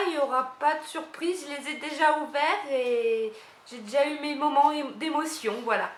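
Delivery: thrilled to finally try this brand; excited. Real, not acted. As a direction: gush about the product, full of joy. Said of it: "je suis super contente".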